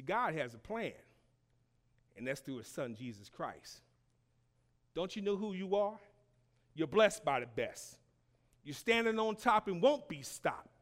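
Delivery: ordinary talk, nothing marked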